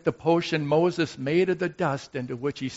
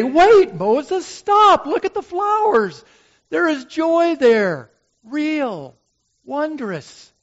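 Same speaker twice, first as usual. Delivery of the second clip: urgent plea; 13 semitones higher, 9 dB louder, slower than usual. Acted. Urging Moses to stop and look.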